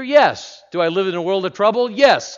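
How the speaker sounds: sharp high answer; emphatic yes. Real, not acted. Preacher answering his own question, high and emphatic. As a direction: snap the answer back.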